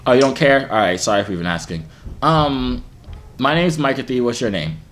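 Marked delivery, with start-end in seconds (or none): none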